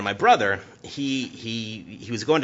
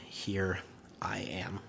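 whispered reply; 10 dB quieter, much slower than usual. Acted. Samuel answering God softly.

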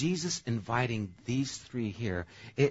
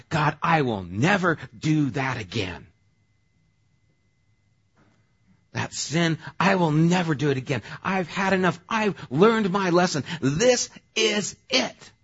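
emphatic vow; 6 semitones higher, 7 dB louder than usual. Acted. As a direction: swear to God you're done for good. Acting out a vow to God.

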